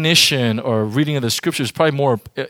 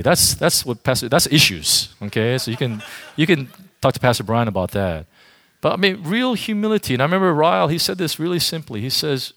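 ordinary delivery

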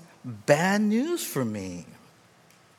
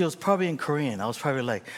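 ordinary delivery

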